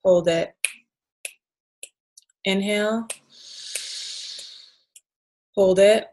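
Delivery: guided breathing. Shown as a demonstration. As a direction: call each breath step calmly. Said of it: "let's just try that one as well".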